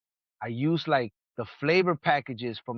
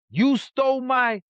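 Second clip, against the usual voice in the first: slow, higher, louder you stole my; a mock furious accusation; angry accusation; 8 semitones higher, 4 dB louder than usual. Veteran accusing Bree.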